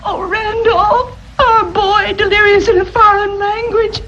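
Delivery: high-pitched melodramatic lament; tearful wail. Acted. Mother lamenting her delirious boy to husband, tearful wail. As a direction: draw out the name, then weep through it.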